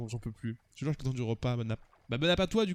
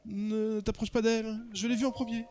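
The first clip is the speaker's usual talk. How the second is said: higher, earnest character voice; lovestruck suitor; 8 semitones higher than usual. Acted. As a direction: play a jealous suitor staking a claim.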